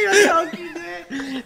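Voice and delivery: slow, high laughing repeat. Real, not acted. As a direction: say it while laughing.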